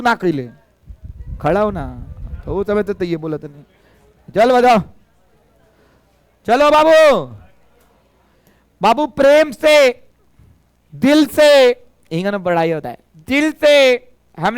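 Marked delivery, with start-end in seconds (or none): 4.3-11.5 higher, louder urging; loud coaxing. Real, not acted. Teacher urging shy students, loud and high. 13.3-13.7 higher, louder urging; loud coaxing. Real, not acted. Teacher urging shy students, loud and high.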